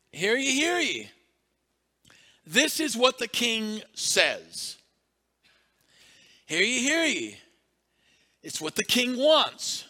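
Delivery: loud, high proclamation; town crier call. Retold or imitated. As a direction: bellow the king's message.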